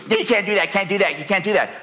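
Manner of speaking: a hint of a small girl insisting; protesting little girl. Granddaughter objecting to her brother, lightly voiced girl. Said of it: "And she goes".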